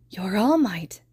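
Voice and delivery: put-on awed child whisper; hushed little whisper. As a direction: breathe it out in wonder. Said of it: "Tenko whispered".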